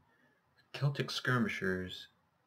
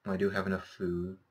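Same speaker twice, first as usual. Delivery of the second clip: ordinary talk, nothing marked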